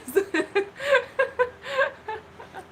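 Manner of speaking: squealing high laugh; high cackling laugh. Real, not acted. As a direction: burst into a shrieking laugh. Loud laugh.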